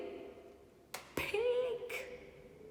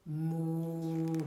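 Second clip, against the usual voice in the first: moo; 4 semitones lower than usual. Acted. Recalling cow noise.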